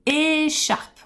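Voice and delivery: "écharpe" with a long held é; "écharpe" slowed, stretched at the start; stressed first syllable. Shown as a demonstration. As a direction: lean on the opening é of "écharpe". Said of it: "On va accentuer la première syllabe".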